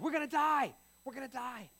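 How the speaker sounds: high, strained cry that they're going to die; hammed-up terror in a high voice; mock panic. Disciples panicking in storm.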